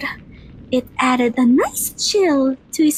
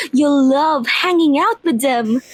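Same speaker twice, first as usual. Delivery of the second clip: usual speaking voice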